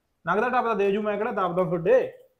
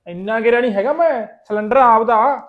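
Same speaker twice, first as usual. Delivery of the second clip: indignant protest; 4 semitones higher, 8 dB louder than usual. Acted. Accused of stealing a cylinder.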